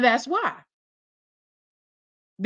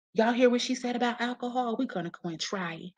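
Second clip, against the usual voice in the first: hushed mock-conspiring kid; imitating impressionable kids; 8 dB quieter than usual. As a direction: softly pass it on like a kid plotting. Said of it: "So they can go and think that".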